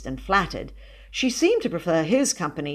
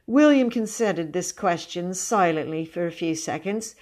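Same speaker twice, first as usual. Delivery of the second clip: usual speaking voice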